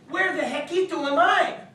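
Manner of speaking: bewildered. Acted. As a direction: ask it bewildered and lost. Character wonders aloud.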